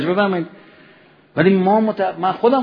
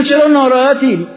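higher, louder caring call; concerned question; 8 semitones higher, 7 dB louder than usual. Retold or imitated. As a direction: call out to a friend who looks down. Asking the believer why upset.

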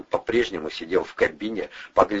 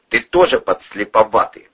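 loud, promising-trouble question; veiled threat; 3 semitones higher, 7 dB louder than usual. A narrator's reading. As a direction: ask it as a threat. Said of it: "многообещающе поинтересовался Бандура".

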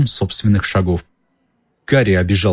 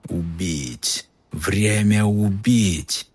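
a hungry monster hissing in the walls; icy murderous voice; 4 dB quieter, much slower than usual. Acted. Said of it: "Голос становился слабее".

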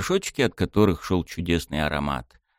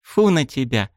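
offended; 6 semitones higher than usual. Acted. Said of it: "обиженно отвернулась она".

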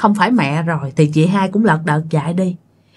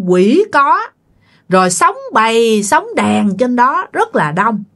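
put-on old land-owner spirit, higher pitched and insistent; possessing land spirit; 4 semitones higher than usual. Land spirit speaking through the mother to the family: stern, aggrieved. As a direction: lay out the grievance like an offended spirit.

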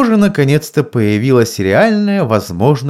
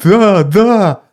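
groaning; 9 semitones higher, 5 dB louder than usual. A narrator's reading. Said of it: "простонал он".

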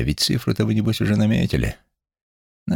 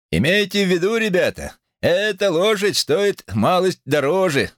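auctioneer; 12 semitones higher than usual. A narrator's reading. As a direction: announce it like an auctioneer. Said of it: "сказал аукционист".

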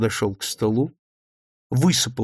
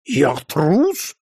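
higher, stung disbelief; offended rising question; indignant surprise; 4 semitones higher than usual. A narrator's reading. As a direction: throw the accusation back, astonished. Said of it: "возмутился Рогачёв и с удивлением".

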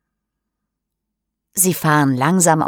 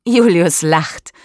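usual speaking voice

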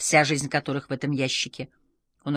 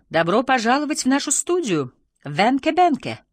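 loud, high-pitched show-host welcome; big, lifted television greeting; TV presenter; 9 semitones higher, 5 dB louder than usual. A narrator's reading. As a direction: announce the guest to the studio audience. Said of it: "раздалось из телевизора".

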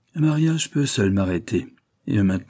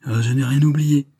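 higher, louder impassioned protest; vibrant voice; 5 semitones higher, 5 dB louder than usual. A narrator's reading. Henri insisting he remembers what she confided, vibrant. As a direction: answer ardently, voice lifted. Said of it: "d'une voix vibrante".

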